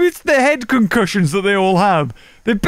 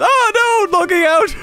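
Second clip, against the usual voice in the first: mock panicked player; 11 semitones higher than usual. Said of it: "he went".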